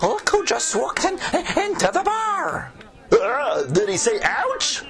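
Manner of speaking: high silly voice. Acted. Peasants at the tavern.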